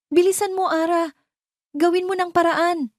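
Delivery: high, loud panicked yell; shouting aunt. A narrator's reading. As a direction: scream it down the phone at her niece. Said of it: "Sigaw ni Tita Lorna".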